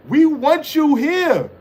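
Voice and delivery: high, slow mock plea; mock pleading. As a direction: beg him to stay, hammed up. Mocking troll, begging him stay.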